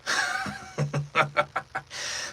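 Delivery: high villain cackle; villain laugh. Acted. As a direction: cackle into the battle speech. Villain laugh before second take.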